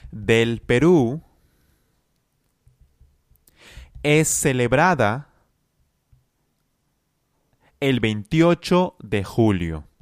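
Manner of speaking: slow, chunked phrases with long gaps; feed it phrase by phrase, slowly, for students to echo; teacher drilling a sentence in chunks; slow repeat-after-me. Shown as a demonstration. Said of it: "Repita conmigo".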